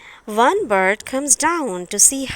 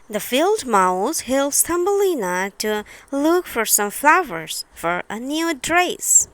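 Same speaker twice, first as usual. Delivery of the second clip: plain reading voice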